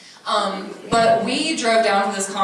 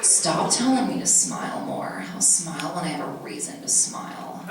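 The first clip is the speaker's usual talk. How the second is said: put-on sullen emo kid, sinking lower and softer; moody emo put-down, dropping away quietly; emo scene kid; 5 semitones lower, 6 dB quieter than usual. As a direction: grumble it like a jaded scene kid. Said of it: "she talks like this".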